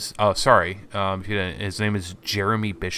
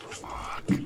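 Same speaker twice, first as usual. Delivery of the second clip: muttered curse; 9 dB quieter than usual. Acted. NPC swears under breath.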